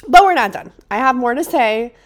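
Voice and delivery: loud, rising burst of determination; emphatic declaration. Real, not acted. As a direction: insist you're not going anywhere. Declaring the podcast continues.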